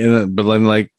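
ordinary delivery

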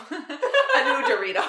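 cracking up mid-word; laughing. Real, not acted. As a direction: laugh through the words. Slip, breaking into laughter.

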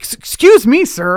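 high, loud stern officer call; movie cop. Pretend. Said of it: "in movies where they're like".

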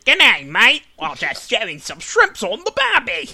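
mock New Zealand accent, nasal Australian; Kiwi accent. Cohost redoing the intro line in Kiwi accent. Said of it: "We could do the New Zealand version".

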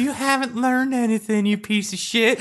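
high mock ghost girl scolding; a shrill-pitched phantom chewing him out. Lisa the ghost girl berates the player, high mock voice.

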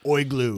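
"oi glue" for igloo, I turned to OY; "oi glue" with a mock Australian first vowel. Shown as a demonstration. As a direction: open "oi glue" with an Aussie OY. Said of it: "they pronounce all their I's like an oi".

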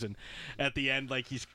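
normal talking voice

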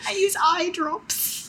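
put-on slow, high child voice, cracking up; British baby voice. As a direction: squeak it as a British tot through laughter. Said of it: "That's my British baby voice".

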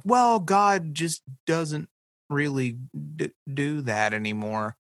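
a lightly voiced preachy adult explaining away miracles; condescending adult. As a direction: talk down like a know-it-all elder, dragging it out. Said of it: "And they're like".

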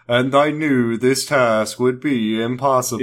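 slow put-on narrator impression; Kyle. Co-host teasing the host's narration, slow imitated voice. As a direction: drag it out in a mock narration voice. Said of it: "Kyle voice".

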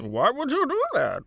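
high, lilting villain voice; supervillain impression. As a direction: question it like a theatrical masked villain. Joins the Bane voice bit.

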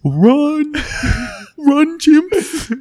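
high, loud panicked screaming; panicked shriek. Acted. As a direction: scream it like fleeing apes. GM voices panicked chimps.

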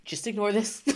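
laughing. Retold or imitated. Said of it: "I'm crying in a, like, a".

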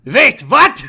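high and loud, a put-on voice; mock gasp of disbelief; hammed-up shock. Character reacts in shock.

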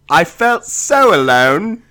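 high, slow, overwrought mock recital; milk the line like a tragic poet; hammed-up poem reading. Cohost, urged to read the bad poem in an accent, hams it up high and slow. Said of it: "should be done in a British accent".

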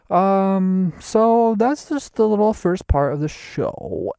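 hammed-up host voice. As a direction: announce it like a cheesy show host. Switches to announcer-like voice.

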